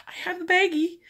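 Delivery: sheepish husband. Retold or imitated. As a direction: offer the bag as a peace gift. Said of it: "He goes".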